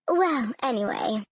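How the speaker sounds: usual speaking voice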